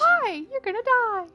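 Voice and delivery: high, loud cartoon mouse squeak; chirpy Disney-style mock sweetness; Mickey Mouse voice. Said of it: "like Mickey Mouse".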